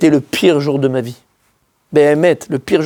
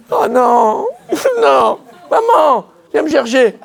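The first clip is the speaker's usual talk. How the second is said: higher, hammed-up childish plea; mock panic; 8 semitones higher, much slower than usual. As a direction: whimper for mom like a scared kid. Panicked boy calls mom.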